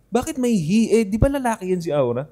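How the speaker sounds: lightly acted baffled question; imitated puzzlement. Podcaster voicing normies questioning a pronoun, mildly puzzled. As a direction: wonder aloud as a confused bystander. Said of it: "magtataka pa rin eh".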